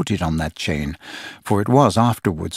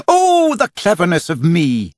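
crowing rapturously; 8 semitones higher than usual. A narrator's reading. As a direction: brag it to the rafters. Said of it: "he crowed rapturously".

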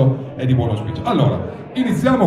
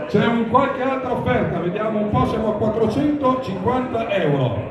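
raised, ringing bid calls; auctioneer calling bids; 7 semitones higher than usual. Real, not acted. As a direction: call out each bid to the room.

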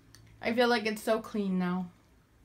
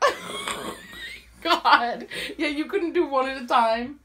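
laughing; 5 semitones higher, slower than usual. Real, not acted. Laughing at her friend.

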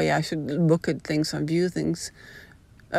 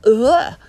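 disgusted ugh; 6 semitones higher, 9 dB louder than usual. Real, not acted. Disgust at kindergarten talk.

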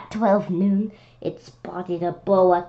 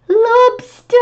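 alarmed shriek; 14 semitones higher, 8 dB louder than usual. Pretend. Reader panics at lobster page.

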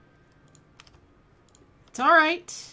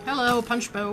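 ordinary delivery

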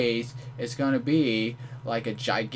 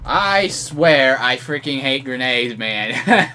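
loud rant; 10 dB louder, slower than usual. Real, not acted. Killed by grenade spam.

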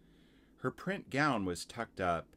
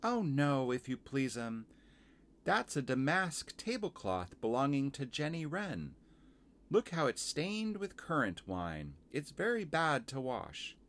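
higher-pitched, polite little laundress; Missus Tiggy Winkle; 6 semitones higher than usual. Acted. Washerwoman explaining stained tablecloth to visitor, higher and polite. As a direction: explain busily in a raised character voice. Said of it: "said Missus Tiggy Winkle".

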